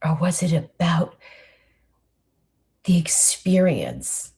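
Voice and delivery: walk the listener back down the mountain gently; guided reflection. Acted. Leads a reflective guided visualization.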